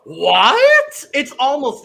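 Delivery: high, loud, rising What; mock surprise. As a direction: act startled, ham it up. Mock shock at show title.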